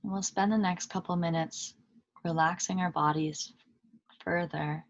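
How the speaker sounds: calm meditation guide with long pauses between phrases; unhurried, even voice settling listeners into stillness; guided meditation. Acted. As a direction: lead them gently inward, leaving long silences.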